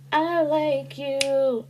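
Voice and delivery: high, slow, drawn-out character voice; put-on character voice. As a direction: stretch it out in a lifted voice. Character line in a shifted voice.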